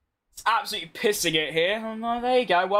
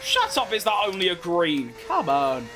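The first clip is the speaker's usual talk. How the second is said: ordinary talk, nothing marked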